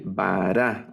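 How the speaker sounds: Bara with stress on the final -ra; acento en la última. Shown as a demonstration. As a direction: stretch Bara slowly toward its last syllable. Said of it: "sino vara". (Bara lento, con el acento en la última sílaba)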